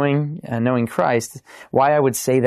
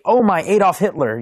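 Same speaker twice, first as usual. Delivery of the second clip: higher-pitched mock outburst; mock cursing; 7 semitones higher than usual. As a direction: swear as if you stubbed your toe. Mock swearing after stubbed toe.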